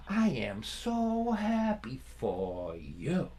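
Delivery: very slow, soft, sarcastic happy-for-you. Retold or imitated. Father sarcastically blessing his pampered son, slow and soft. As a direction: drawl it out, dripping with sarcasm.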